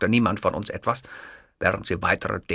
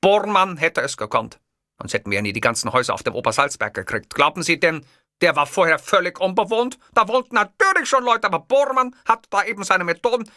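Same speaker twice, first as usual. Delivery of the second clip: higher, louder indignant dictator voice; Hitler lecturing; 10 semitones higher, 5 dB louder than usual. Acted. Hitler explaining names to the clerk, indignant. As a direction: lecture her like a rally crowd. Hitler ranting about Bormann.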